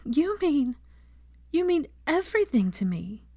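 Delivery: high, trembling earnest voice; shaking voice. Acted. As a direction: tremble with emotion. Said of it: "her voice shaking slightly".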